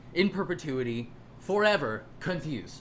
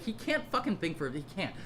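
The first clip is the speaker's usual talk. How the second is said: usual speaking voice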